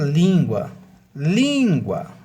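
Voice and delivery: "língua" slowly, LIN high and long; LIIINgua, strong first syllable; stress on LIN. Shown as a demonstration.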